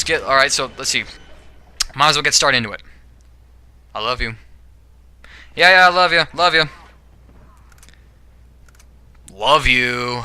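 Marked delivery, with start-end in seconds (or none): 3.9-4.4 soft, sincere I love you; a quiet heartfelt I love you. Shown as a demonstration. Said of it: "is sincere and honest". 5.6-6.7 casual, dismissive yeah yeah I love you; offhand brush-off. Shown as a demonstration. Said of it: "okay, yeah, you know ... how you'd say it to your parents". 9.3-10.2 reluctant, forced love you; grudging bittersweet love you; pestered. Shown as a demonstration. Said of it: "bittersweet ... being pestered into saying it".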